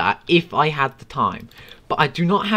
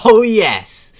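louder, higher cheer; excited; 7 semitones higher, 11 dB louder than usual. Real, not acted. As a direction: celebrate a big moment. Cheering in game.

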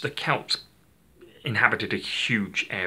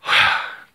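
loud, breath-only sigh; calming exhale; 8 dB louder than usual. Real, not acted. Presenter settling himself after a rushed introduction, calming exhale. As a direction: let out a big breath to calm down. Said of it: "now I'll calm down".

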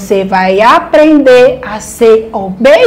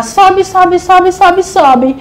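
each sobe pitched higher, like climbing up; 7 semitones higher, slower than usual. Acted. Teacher showing children the spider climbing, rising pitch. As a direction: lift your voice up the wall with the spider.

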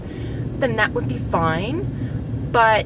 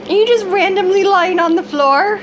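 much higher and louder sing-song; 10 semitones higher, 5 dB louder than usual. Real, not acted. Mom teasing her little son on the floor, high sing-song. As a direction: coo at him like a delighted mom.

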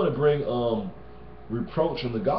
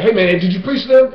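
loud and higher, in his face; pushy demand; 7 semitones higher, 11 dB louder than usual. Retold or imitated. Friend demanding whether he preached, loud mock in-your-face voice. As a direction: imitate someone pressing him hard. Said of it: "And he's like".

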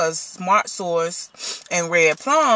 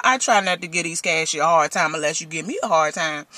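ordinary talk, nothing marked

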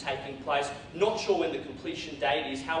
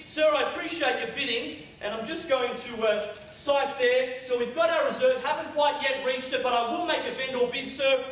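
auctioneer calling; 10 semitones higher, 4 dB louder than usual. Acted.

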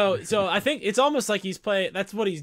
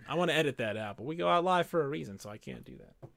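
ordinary talk, nothing marked

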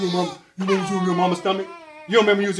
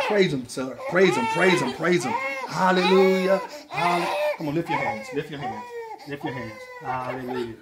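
mock preaching; 2 semitones lower, 4 dB quieter than usual. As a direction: lead a little praise service, quietly. Preaching to calm baby.

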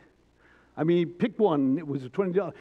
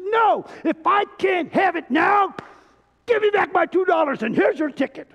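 put-on grumpy old man shouting; 7 semitones higher than usual. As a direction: throw the ticket back in a huff. Grumpy man tells manager off.

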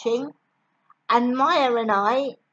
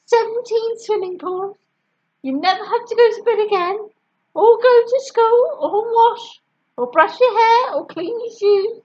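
high, fast frantic bribing; desperate pleading mum; 8 semitones higher, 4 dB louder than usual. A narrator's reading. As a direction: pile it on, higher and faster.

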